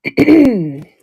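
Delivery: hoarse, worn-out voice; straining with a tired throat; losing voice. Real, not acted. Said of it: "Really losing my voice here".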